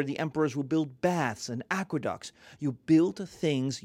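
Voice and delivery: usual speaking voice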